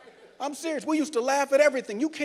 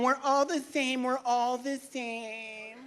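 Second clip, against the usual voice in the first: mock sincere; 2 semitones higher, 7 dB quieter, slower than usual. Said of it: "And people going".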